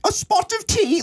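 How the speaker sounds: put-on British accent pushed way up into falsetto; prim British accent, sung up high in falsetto; mock British accent in falsetto. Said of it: "I could never do a British accent unless you put it up an octave".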